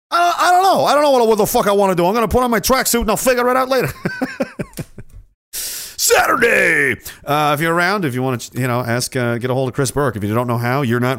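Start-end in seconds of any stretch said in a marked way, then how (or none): 0.1-3.9 exasperated mobster. Acted. Mobster character rants. 3.9-5.2 laugh. Real, not acted. Laughs at own bit. 5.5-7.0 very slow stretched shout; drawn-out shout. Acted. Hyping the weekend meetup to viewers, long stretched shout.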